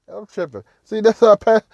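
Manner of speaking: usual speaking voice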